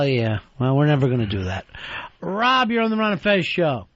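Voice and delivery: ordinary delivery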